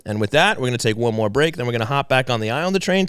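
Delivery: ordinary talk, nothing marked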